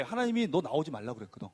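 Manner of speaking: ordinary talk, nothing marked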